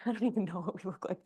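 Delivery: rushed amused giggle mid-cue; laughing aside. Real, not acted. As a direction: laugh it off under your breath. Laughs at how the class looks.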